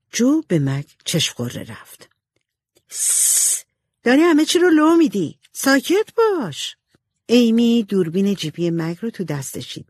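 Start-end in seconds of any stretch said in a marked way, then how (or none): 2.9-3.6 sharp unvoiced shush; hushing. A narrator's reading. Jo hushes Meg before she spills it, a sharp shush. 4.0-6.8 high, urgent scolding, slowing on the last words; scolding hush. A narrator's reading. Jo scolds Meg for giving it away, urgent.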